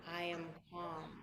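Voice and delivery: hushed, soothing affirmation; calm. Shown as a demonstration. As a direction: let it settle gently, pausing between repeats. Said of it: "notice how you feel when you say".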